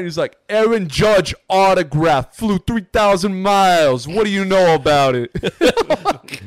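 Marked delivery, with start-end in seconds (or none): 0.5-5.3 put-on New York accent. Imitating the fan showing off his autograph, New York accent. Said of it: "So New York".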